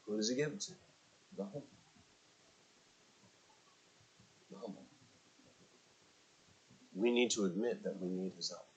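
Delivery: hushed pulpit appeal; soft preaching. Acted. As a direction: draw them in quietly.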